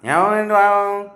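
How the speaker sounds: high, loud mock meow; imitated animal cry. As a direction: call out a drawn-out animal noise.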